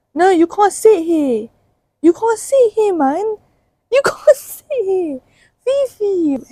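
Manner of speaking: telling Fifi she can't sit here, in a high mock voice; mean girl voice. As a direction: play the snooty girl refusing a seat. Mock gatekeeping friend Fifi.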